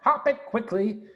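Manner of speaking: high, needling threat, slowing on the last line; a small creature making big threats; squeaky rat bluffing menace; menacing Rat. Acted. Rat threatening Mister Fox.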